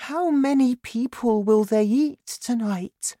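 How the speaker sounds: put-on high-pitched little girl asking; worried girl. A narrator's reading. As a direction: ask high and anxiously, as a child. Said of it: "Sophie asked".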